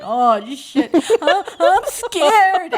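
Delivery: high, loud scared squeal; mock panic. Telling friends how she froze atop the wall, high loud panicked squeal. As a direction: squeal it like you're stuck up top.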